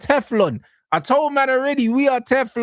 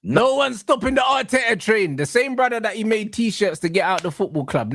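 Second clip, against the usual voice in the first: normal talking voice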